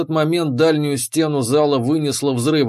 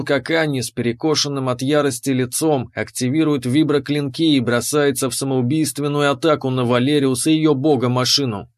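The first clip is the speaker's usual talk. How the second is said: ordinary delivery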